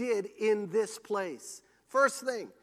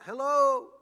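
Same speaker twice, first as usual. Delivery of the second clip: higher, louder imitated call; yelled hello; 4 semitones higher, 6 dB louder than usual. Preacher imitating a man at Echo Point, yelling out. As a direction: call it out as into a canyon. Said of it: "and he yelled out".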